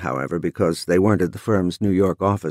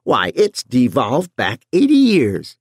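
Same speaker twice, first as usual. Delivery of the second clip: brassy housewife; 3 semitones higher, 4 dB louder, slower than usual. A narrator's reading. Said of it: "In a brassy voice, she bellowed".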